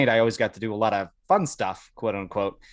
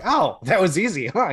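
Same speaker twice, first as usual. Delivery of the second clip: mock impressed; 9 semitones higher, 5 dB louder than usual. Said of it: "I was like".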